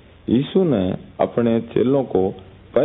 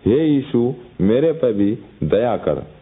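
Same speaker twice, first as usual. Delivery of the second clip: ordinary talk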